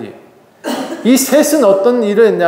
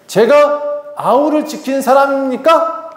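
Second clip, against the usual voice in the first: high, slow indignant question; acted insolent rebuttal; defiant retort; 6 semitones higher, slower than usual. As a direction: talk back, stretching it into a rising challenge. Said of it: "항명을 해요".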